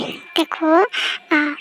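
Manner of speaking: ordinary talk, nothing marked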